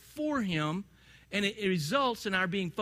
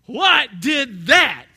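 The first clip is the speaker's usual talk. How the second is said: mock spooked question; 6 semitones higher, 13 dB louder, much slower than usual. Hearing things fall at night.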